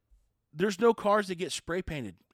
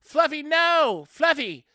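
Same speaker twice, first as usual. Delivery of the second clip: much higher, loud frantic yell; yelling owner; 10 semitones higher, 10 dB louder, slower than usual. Retold or imitated. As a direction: shout the dog's name in desperation. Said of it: "the owner's yelling".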